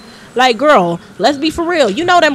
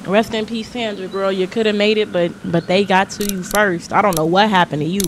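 soft, low mock eulogy; mock mourning; 5 semitones lower, 5 dB quieter than usual. Paying mock respects to dead Sandra, hushed. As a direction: lower the voice like at a funeral.

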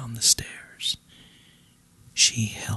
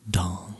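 a single ringing "dong"; imitated clock chime; 4 semitones lower than usual. A narrator's reading. Clock striking midnight at the ball, imitated chime. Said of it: "rung the clock".

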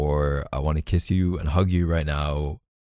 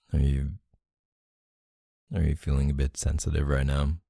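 ordinary talk

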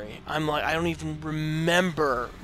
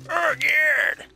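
mock grunt; 14 semitones higher, 10 dB louder than usual. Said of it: "it's like".

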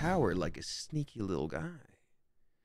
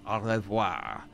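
mock French goodbye; 5 semitones lower, slower than usual. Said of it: "that's French for a".

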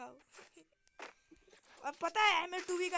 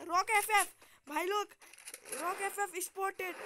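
excited callout; 6 semitones higher, 5 dB louder than usual. Real, not acted. Calls out spotting a known player.

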